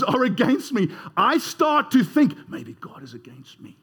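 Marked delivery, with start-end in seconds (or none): 2.5-3.8 much softer and lower, a private doubt; doubting inner voice. Retold or imitated. Preacher voicing his own doubt, hushed and low. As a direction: drop to a hushed worry.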